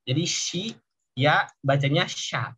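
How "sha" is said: shi and ya blended into one "sha"; merged syllable. Shown as a demonstration. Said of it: "Jadi lebih dirangkap".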